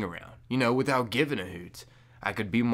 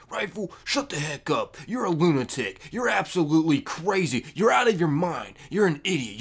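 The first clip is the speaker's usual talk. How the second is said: higher, louder jeering hater voice; higher, louder mock hater rant; 7 semitones higher, 4 dB louder than usual. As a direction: play an angry commenter ranting at Rifle. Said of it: "commenting like".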